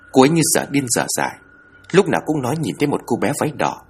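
usual speaking voice

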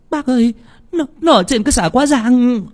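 put-on high, panicked call to the old man; frightened cry. A narrator's reading. Woman warns the old villager about the head, frightened cry. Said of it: "Chị Tơ hoảng hốt la lên".

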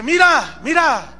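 high, loud insistent call. Acted. David calls Saúl to look at his proof, high loud voice. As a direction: make him look from afar.

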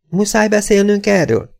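high, complaining mother voice; high-pitched reluctant question. A narrator's reading. Mother objects, voiced in story.

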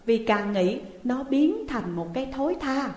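ordinary delivery

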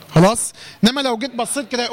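ordinary talk

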